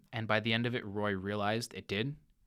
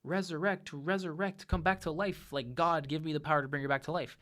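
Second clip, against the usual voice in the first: high-pitched pleading yell, imitated, not full force; mock yell; 5 semitones higher than usual. Man at his dead wife's side calling on God, mock yell. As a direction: call on him to bring her back, in a raised pleading voice. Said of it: "yelling, like".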